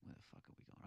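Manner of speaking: under-the-breath mutter to himself; muttered aside. Real, not acted. Podcast host muttering while losing his place in the list, barely voiced. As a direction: mumble it, almost to yourself.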